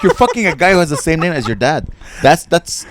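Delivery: loud, high outraged disbelief; incredulous comeback. Retold or imitated. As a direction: throw the hypocrisy back at her, loudly. Incredulous comeback to offended heckler.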